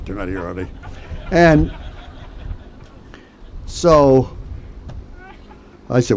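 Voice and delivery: ordinary delivery, nothing marked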